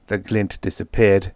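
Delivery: ordinary delivery, nothing marked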